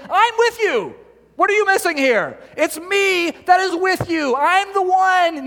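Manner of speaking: the Lord insisting to his doubting people, high, slow and emphatic; insistent God. Retold or imitated. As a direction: press it home that God is with them.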